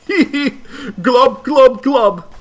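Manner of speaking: loud bubbly glub noises; playful cuttlefish glubbing; giggly fish glubs. Acted. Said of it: "let's stop being silly".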